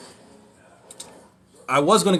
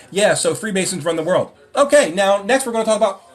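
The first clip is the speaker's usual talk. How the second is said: mock YouTuber; 6 semitones higher, 5 dB louder than usual. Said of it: "they just go".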